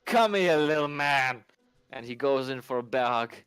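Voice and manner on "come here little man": found you, come here; drunk NPC voice. Acted. Drunk NPC finds friend.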